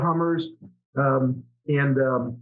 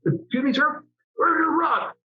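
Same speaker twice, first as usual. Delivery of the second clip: high, shouted southern bark; a Southern commander yelling the question; southern accent; 10 semitones higher than usual. Retold or imitated. Commander shouting at the unprepared pilot, southern accent. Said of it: "he had this southern accent".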